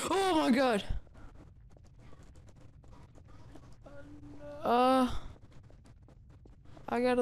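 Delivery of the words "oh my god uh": startled cry. Real, not acted. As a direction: jump at the scare. Jump scare reaction.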